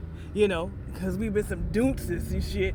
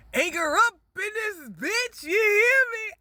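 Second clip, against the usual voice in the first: much higher, louder hyped call; hyped shout; 14 semitones higher, 6 dB louder than usual. Real, not acted. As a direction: shout it out, fired up.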